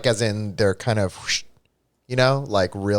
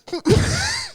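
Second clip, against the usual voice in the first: high squealing giggle. Real, not acted. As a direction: burst of high helpless giggling. Cracking up mid-scene.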